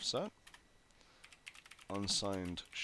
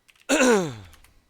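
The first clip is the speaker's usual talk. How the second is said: a loud harsh throat clear; real throat clear between lines; 11 semitones higher, 19 dB louder than usual. Real, not acted. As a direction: clear a phlegmy throat hard. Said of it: "super phlegmy tonight".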